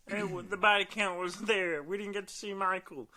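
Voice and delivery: high whiny complaining voice; mocking whiny fan. Mocking fans again.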